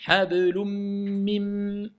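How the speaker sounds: "hablum mim" very slow, fused on a long hummed m; "hablum mim" stretched out on a steady nasal note; idgham demonstration. Shown as a demonstration. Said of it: "je vais dire".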